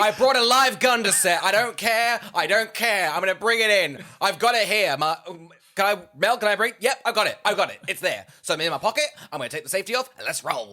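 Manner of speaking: overexcited actor boasting about a live gun on set, loud and high; hammed-up Mark Wahlberg. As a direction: yell it like a gun-toting hothead actor. S0 takes over as Wahlberg.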